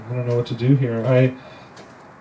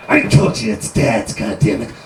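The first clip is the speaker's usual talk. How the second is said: defiant prisoner; 3 semitones lower, 4 dB louder than usual. Acted. Prisoner snapping back.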